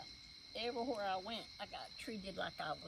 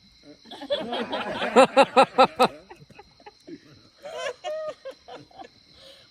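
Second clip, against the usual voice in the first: loud, high, long burst of real laughter; howling with laughter; big laugh; 20 dB louder than usual. Real, not acted. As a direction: roar with laughter. Laughing at the Willie story.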